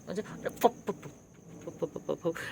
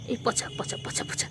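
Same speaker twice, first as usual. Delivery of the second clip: whispered mock scolding of the cat; hushed teasing; 5 semitones higher, 8 dB quieter, slower than usual. Real, not acted. To the cat, a soft whispered tease. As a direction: breathe the words softly at the pet.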